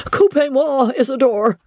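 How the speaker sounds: high, urgent French shout; frantic shout. A narrator's reading. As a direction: shout it high at the servant in panic. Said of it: "shouted he".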